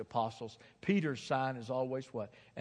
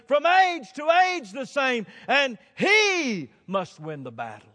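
slow, very high, shouted proclamation; triumphant hymn declamation; 13 semitones higher, 13 dB louder, slower than usual. Retold or imitated. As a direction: shout the verse out in triumph, drawing it out. Recites hymn climax at full force.

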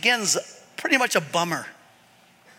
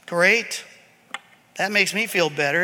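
sarcastic; 6 semitones lower, 4 dB quieter than usual. Acted. Sarcastic reply to no God.

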